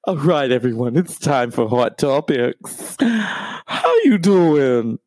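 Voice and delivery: slow hammed-up host catchphrase; Wendy Williams impression. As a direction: ham up Wendy opening Hot Topics. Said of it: "I'm not like Wendy Williams".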